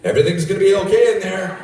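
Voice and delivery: loud mock-reassuring guard; calling through a door. Guard to stranger in a bathroom stall, calling out loudly. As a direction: call it loudly to someone shut in a stall.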